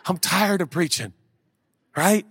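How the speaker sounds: usual speaking voice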